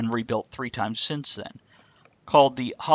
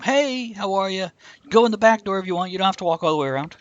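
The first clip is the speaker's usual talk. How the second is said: higher, faster friendly greeting; friendly call-out; 7 semitones higher, 3 dB louder than usual. Retold or imitated. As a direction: call out cheerfully and quickly. Voicing friendly guys at fish fry.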